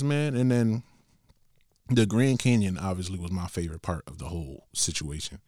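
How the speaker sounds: ordinary delivery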